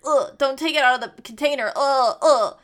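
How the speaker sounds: loud disgusted mock owl, grossed out by bugs; hammed-up squeamish curator groaning ugh; imitating Blathers. Curator shown a bug, loud grossed-out groan. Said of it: "and he's like".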